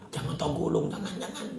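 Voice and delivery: hushed whisper pleading not to correct. Retold or imitated. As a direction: whisper urgently so nobody hears. Hushing someone during tadarus.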